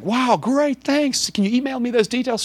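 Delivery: high-pitched, eager imitation; delighted thanks. New friend asking for trip details, imitated delight.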